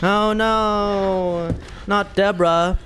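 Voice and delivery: ordinary talk, nothing marked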